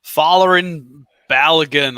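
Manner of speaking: Folarin Balogun in a loud, slow American accent. Shown as a demonstration. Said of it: "I'm gonna say it in an American accent".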